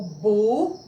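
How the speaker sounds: BU said alone with emphasis; isolated, held bu; stressed syllable. Shown as a demonstration. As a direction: pick out bu as the strong syllable. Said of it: "é sílaba tônica".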